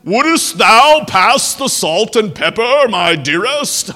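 hammed-up King James voice to a wife, slow and loud; pompous churchy tone over the dinner table; mock pious voice. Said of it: "do they talk to their wife like that".

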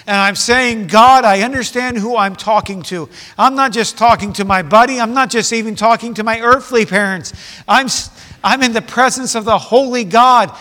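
loud, driving sermon delivery; fervent pulpit preaching; full-force preaching. Acted. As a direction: preach it out to the whole room, building.

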